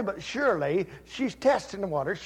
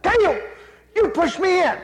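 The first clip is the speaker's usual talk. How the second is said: put-on high, loud scream of a pushed girl; yelling in shock after being shoved in; retold scream; 12 semitones higher, 9 dB louder than usual. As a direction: shriek it at her little brother. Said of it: "She screams real loud".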